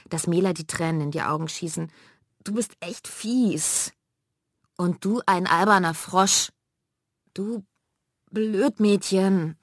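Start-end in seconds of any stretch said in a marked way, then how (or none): 2.4-3.9 slow, higher hurt voice; little sister near tears after being pinched; tearful reproach. Acted. Weinerlich, langsam und hoch. 4.8-9.6 sister hitting back in a quarrel; angry insult. Acted. Laut und höhnisch geschimpft.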